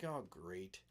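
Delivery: low, soft deadpan; sarcastic dread. Retold or imitated. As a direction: mutter it like trouble is coming. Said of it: "So I'm like".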